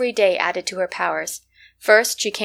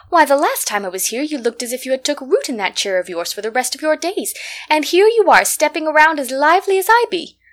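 higher-pitched, astonished visitor exclaiming; delighted surprise; 5 semitones higher, 5 dB louder than usual. A narrator's reading. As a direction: marvel aloud, rising to a louder peak then easing. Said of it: "screamed with surprise at the sight of it".

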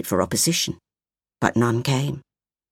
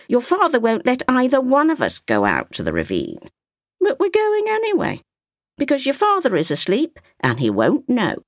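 a hint of an old woman explaining; lightly voiced grandmother; 12 semitones higher, 4 dB louder than usual. A narrator's reading. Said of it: "the old woman answered".

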